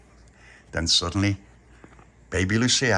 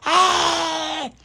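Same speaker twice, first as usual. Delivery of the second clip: high, loud shriek; scream; 5 dB louder than usual. Retold or imitated. Pauline suddenly right there, loud shriek. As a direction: shriek in fright. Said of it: "Like that".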